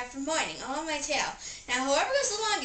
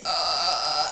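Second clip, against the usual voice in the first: burp; 9 semitones lower, 5 dB louder than usual. Acted. Burping contest, burps.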